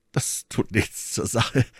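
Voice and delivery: mildly flustered, drawn-out reply; blushing. A narrator's reading. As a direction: brush it off with a blush, taking your time. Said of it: "antwortete Aramis errötend".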